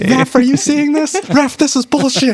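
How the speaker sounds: high-pitched mock protest; mock outrage. Player calling on Raph to throw someone out, mock outrage.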